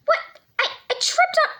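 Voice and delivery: high startled cry after tripping; startled yelp. Acted. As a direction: yelp as if you just tripped. Pretends to trip.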